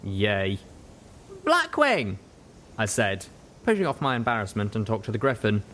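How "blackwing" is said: Pip calling out. Acted. Pip calls out to the Griffin.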